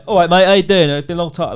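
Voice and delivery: put-on loud, breezy London accent greeting; casual London accent. Recalling his old London speech, a loud, breezy London accent. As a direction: throw out a quick London accent "all right, mate". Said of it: "That's how I used to speak".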